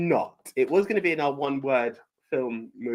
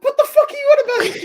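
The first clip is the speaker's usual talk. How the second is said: high, loud, fast cracked yell; laughing mock outrage; 9 dB louder, faster than usual. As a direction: shriek it through laughter. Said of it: "You're like".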